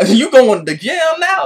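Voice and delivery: loud, high, drawn-out gloating; mock villain. Unalaq jumps in on his brother, hammy loud gloat. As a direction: crow it loud and slow, hammed up. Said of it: "he's like".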